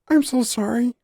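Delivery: put-on high, pleading little forest girl; pleading fawn girl. A narrator's reading. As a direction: beg forgiveness in a high voice. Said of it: "the girl pleaded".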